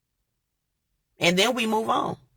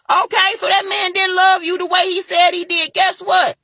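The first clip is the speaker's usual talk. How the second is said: fired-up shout; 10 semitones higher than usual. Real, not acted. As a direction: call it out with fire. Heated advice about a bad man.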